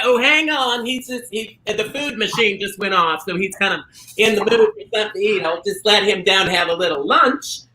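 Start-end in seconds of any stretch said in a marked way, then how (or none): none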